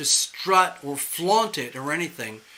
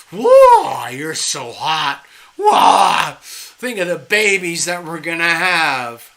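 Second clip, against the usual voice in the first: loud hammed-up whoa, like a smitten tomcat; mock lustful shout; 11 dB louder, slower than usual. Guys going berserk over a radiant woman, hammed-up loud shout. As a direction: shout it out, overcome with lust. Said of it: "They're like, just like".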